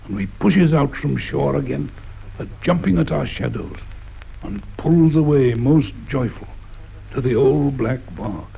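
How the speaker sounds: ordinary delivery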